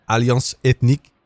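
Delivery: careful French pronunciation of the name; French accent. Real, not acted. Host announcing a French hip hop group, careful French accent. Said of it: "you said that well".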